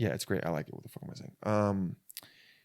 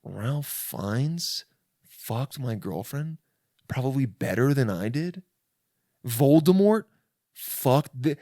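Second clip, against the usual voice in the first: mock-incredulous boyfriend, building louder and higher; humiliated disbelief, hammed up; embarrassed disbelief; 4 semitones higher, 4 dB louder, slower than usual. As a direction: start stunned, build to outraged questioning. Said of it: "embarrassing because you're like".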